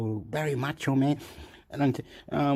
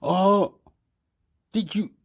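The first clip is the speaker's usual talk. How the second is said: surprised; 8 semitones higher, 4 dB louder, slower than usual. Retold or imitated. Surprised exclamation.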